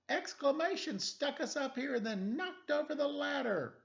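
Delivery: high, urgent character voice; lightly voiced excited character. Stranded on the sign, explaining to the detective. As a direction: tell it in a rush of alarm.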